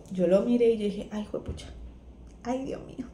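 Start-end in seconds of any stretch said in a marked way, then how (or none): 1.1-1.7 soft, near-whispered retold oath; hushed dismay. Wife to husband as waters break, hushed dismay. 2.4-3.1 soft retold "oh my God"; hushed dismay. Wife looking at husband, hushed dismay. First take of acted reaction.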